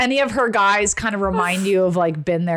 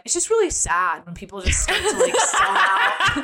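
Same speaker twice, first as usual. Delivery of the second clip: Garth impression; 10 semitones higher than usual. Garth quote, falsetto laugh.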